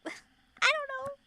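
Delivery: high squeaky laughing reply. Real, not acted.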